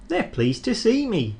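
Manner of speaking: high, pleased voice; proud little engine. Acted. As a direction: sound chuffed with yourself. Thomas thinking proudly.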